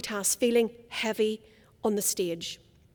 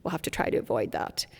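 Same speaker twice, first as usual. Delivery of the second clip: much lower, creaky fry demo; vocal fry; 13 semitones lower, 6 dB quieter than usual. Shown as a demonstration. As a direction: let the voice rattle low in fry. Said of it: "Vocal fry is whenever your voice ends up down here".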